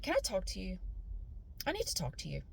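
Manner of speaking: low and hushed, quoting him; a quiet, pointed pull-aside; hushed boyfriend voice; low quiet request. Retold or imitated. Boyfriend pulls her aside.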